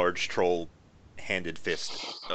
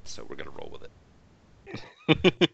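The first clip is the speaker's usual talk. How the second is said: ordinary talk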